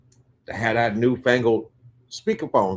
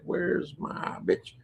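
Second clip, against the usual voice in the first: slow, higher, softer retold demand; serious demand; 5 semitones higher, 8 dB quieter, much slower than usual. Pimp asking for his woman on the phone, slow serious demand. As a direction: draw out each word as a serious question. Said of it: "he was serious".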